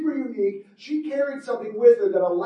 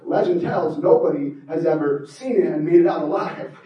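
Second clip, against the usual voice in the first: ordinary delivery, nothing marked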